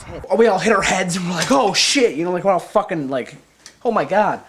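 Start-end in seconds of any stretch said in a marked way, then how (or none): 1.5-2.2 loud, high re-enacted oh-shit moment; shocked outcry. Retells heads hitting. 3.8-4.4 louder, raised re-enacted shock; shocked reaction. Retells reaction to crash.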